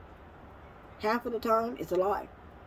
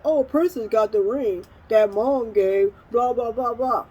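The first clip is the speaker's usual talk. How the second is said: louder mimicry of griping relatives; mock their petty griping, trailing into blah-blah; dismissive imitation of jealous family; mocking complaint; 3 semitones higher, 5 dB louder than usual. Mimicking relatives' complaints about the ring.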